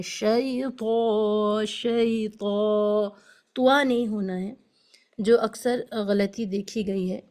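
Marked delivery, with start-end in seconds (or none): 0.0-3.1 شیطا with a full heavy طا, very slow. Shown as a demonstration. Teacher showing the correct heavy sound, slowly. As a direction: round the tongue and draw out شیطا. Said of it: "sound heavy ہو جائے".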